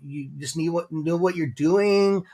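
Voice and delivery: ordinary talk, nothing marked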